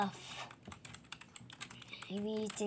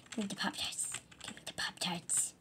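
sneaky whisper; 7 dB quieter than usual. Acted. Sneaking to steal Pop-Tarts.

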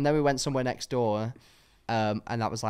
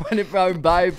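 louder, higher flirty echo; camp flirt; 6 semitones higher, 8 dB louder than usual. Pretend. Friend echoes the doorman, loud flirty tease. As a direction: purr it like a cheeky tease.

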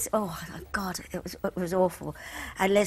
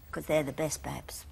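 whisper; 5 semitones lower, 7 dB quieter than usual. Retold or imitated. Mother confiding about bras.

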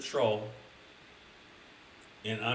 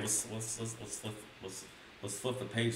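hushed buildup; 3 semitones lower, 9 dB quieter than usual. Real, not acted. Hushed buildup before page turn.

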